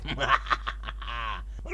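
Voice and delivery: gloating villain chuckle; mock evil laugh. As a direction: laugh wickedly at the victim. Gloats over starving badger.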